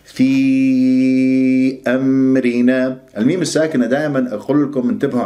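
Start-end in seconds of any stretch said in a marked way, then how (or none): 0.0-3.0 في held very long before أمرنا; separated madd. Shown as a demonstration. Teacher showing four or five counts, long sustained في. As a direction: stretch the ee of في on a steady chant. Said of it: "إذن مد جائز منفصل".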